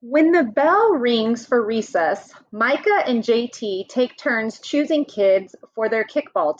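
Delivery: lively picture-book read-aloud; reading to children. Acted. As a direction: read it out to the class like a storybook. Teacher reads picture book to class.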